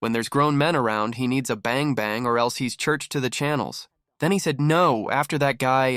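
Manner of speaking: ordinary talk, nothing marked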